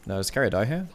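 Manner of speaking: ordinary talk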